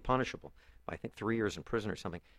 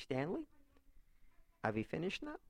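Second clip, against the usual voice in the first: put-on higher, softer Yiddish-accented motherly question; mother's Yiddish accent; 8 semitones higher, 6 dB quieter than usual. Son recalls his mother asking if he finished, Yiddish accent. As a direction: imitate an old Yiddish mother gently checking on her son. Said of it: "This is actually the way she talked".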